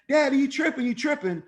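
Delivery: hammed-up teen girl complaint; upset daughter. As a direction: play the daughter protesting to dad. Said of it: "if she gets upset".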